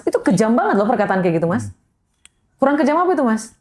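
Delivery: ordinary delivery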